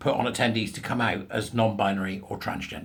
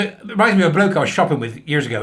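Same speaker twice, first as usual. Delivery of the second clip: normal talking voice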